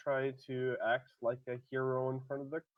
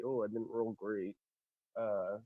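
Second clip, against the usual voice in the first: ordinary delivery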